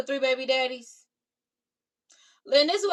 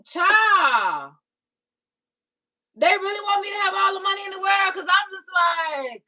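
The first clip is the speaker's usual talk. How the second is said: exasperated shout; 7 semitones higher, 5 dB louder than usual. Real, not acted. Ranting at chat about money.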